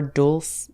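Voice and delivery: dulce said as DOOLS; another one-syllable guess. Shown as a demonstration. Vlogger keeps guessing, dulce as one-syllable DOOLS. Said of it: "I, I can't".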